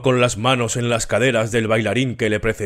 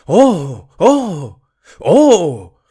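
high, loud rapturous ohs; a worshipper overcome with rapture; ecstatic exclamation; 6 semitones higher, 6 dB louder, much slower than usual. A narrator's reading. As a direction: gasp out in ecstasy. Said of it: "exclamó Joanna".

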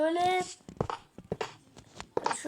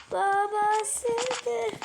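loud, drawn-out exclamation; shouted catchphrase; 7 semitones higher, 4 dB louder than usual. Acted. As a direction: belt out the catchphrase. Catchphrase shout while playing.

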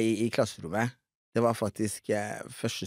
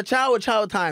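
higher, louder chow time echo; mock announcer call; 9 semitones higher, 4 dB louder, slower than usual. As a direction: echo the host call playfully. Imitates the show call. (etterligner programleder-rop)

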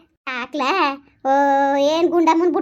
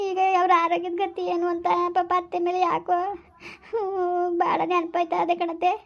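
weeping new bride missing the aunt who raised her; tearful bride; 4 semitones higher than usual. Acted. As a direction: sob out how much she misses her mother-in-law. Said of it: "ಯಾಕ್ ಅಳ್ತಾ ಇದ್ದೀ?".

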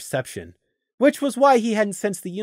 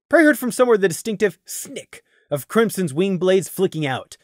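usual speaking voice

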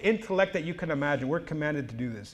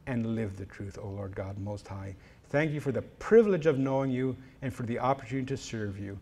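closing prayer; 3 semitones lower than usual. Acted. Preacher closes his sermon by praying for the listeners, reverent prayer. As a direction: pray it reverently, addressing the Lord.

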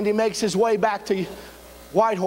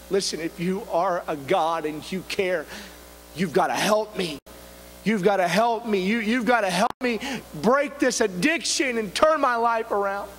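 usual speaking voice

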